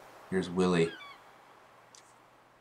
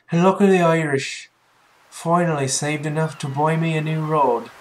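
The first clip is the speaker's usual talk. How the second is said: high, loud Irish brogue; Irish accent; 7 semitones higher, 6 dB louder than usual. Acted. Fisherman Willy tells the newcomer about his new rod, Irish accent. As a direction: ham up a fisherman's Irish lilt, higher and louder. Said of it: "he has the Scottish accent".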